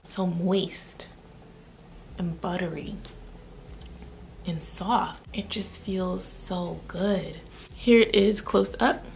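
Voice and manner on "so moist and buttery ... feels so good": slow, whispered and much softer; dreamy whispered pleasure; savoring whisper. Real, not acted. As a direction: sink into the feeling and breathe it. Savoring the lip product.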